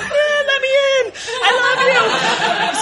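very high, slow, half-laughing wail; a hammed-up cry to be let in; desperate plea. Younger sister begging her grounded sisters, high wailing plea.

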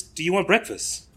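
an imitated British landlord offering breakfast; casual offer in an imitated British accent; British accent attempt. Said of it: "my attempts to do the accent".

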